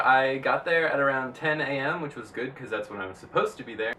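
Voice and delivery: ordinary delivery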